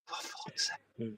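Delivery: whispered laughing. Retold or imitated. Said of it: "she just starts laughing, going".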